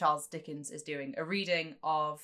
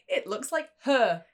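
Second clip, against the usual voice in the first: mock Dickens; 7 semitones higher, 6 dB louder than usual. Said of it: "He's like".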